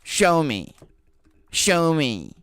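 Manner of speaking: high, loud mocking demand; demanding customer. Imitating the customer demanding to see signs, loud and high. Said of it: "she's like".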